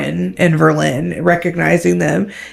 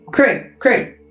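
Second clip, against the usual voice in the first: pleading interruption; 6 semitones higher than usual. Acted. Talarin cuts off excited Craig.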